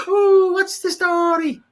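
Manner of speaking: high, slow hooting Irish greeting; Irish accent. Shown as a demonstration. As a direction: draw out a high comic Irish whoop. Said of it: "but I always go for the".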